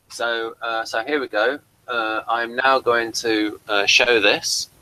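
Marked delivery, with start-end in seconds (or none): none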